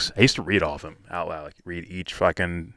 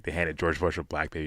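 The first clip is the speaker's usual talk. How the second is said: ordinary talk, nothing marked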